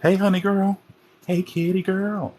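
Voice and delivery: high, sweet coo to a kitty; sing-song greeting to a little animal; pet talk. Real, not acted.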